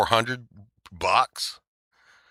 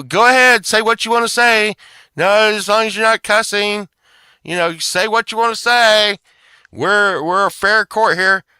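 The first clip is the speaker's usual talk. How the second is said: much higher and louder throughout, sarcastic; mock yell; 11 semitones higher, 9 dB louder than usual. Mocking her fake fair-court speech, loud and high. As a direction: hammer it out as a bullying official.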